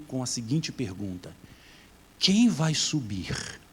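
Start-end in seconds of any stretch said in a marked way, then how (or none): 2.2-3.6 preaching call. Acted.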